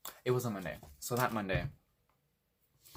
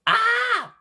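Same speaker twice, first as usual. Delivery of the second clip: sharp high yelp of pain, reenacted; retold scream; 19 dB louder than usual. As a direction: scream out like something just hurt you. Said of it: "Like, I let out a scream".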